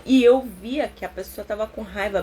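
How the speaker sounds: ordinary talk, nothing marked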